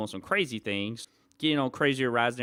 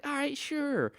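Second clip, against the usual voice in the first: mock high sure; slower than usual. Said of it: "I was like".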